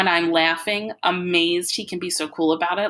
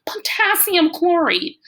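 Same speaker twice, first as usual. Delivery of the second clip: higher, louder and slow; excited shout; 12 semitones higher, 5 dB louder, slower than usual. A narrator's reading. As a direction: keep calling out the chemicals. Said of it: "he shouts".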